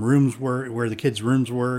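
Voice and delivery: ordinary talk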